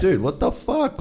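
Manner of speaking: high-pitched retold outburst at normal volume; mock scream. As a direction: pitch leaps up in protest, volume held back. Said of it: "screaming at him".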